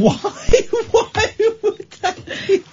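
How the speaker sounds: high squeaky laugh through words; laughing disbelief. Real, not acted.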